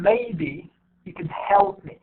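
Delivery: MAYBE and HELP drawn out, "you could" squeezed short; stress on MAYBE, HELP. Shown as a demonstration. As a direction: lean on maybe and help, lighten "me". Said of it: "you see how I pronounce it".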